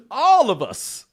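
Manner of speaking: high, slow laughing delivery; real laughter. Real, not acted. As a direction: laugh through the punchline. Laughing at the punchline.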